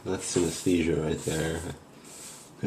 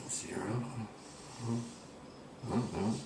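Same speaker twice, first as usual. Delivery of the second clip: whisper; 4 semitones higher, 16 dB quieter than usual. Acted. Reading out Ouija letters.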